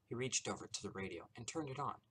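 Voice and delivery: ordinary delivery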